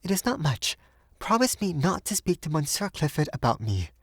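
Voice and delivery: put-on gently pleading young woman; gentle. A narrator's reading. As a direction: make a gentle plea, pitched high. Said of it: "she said gently".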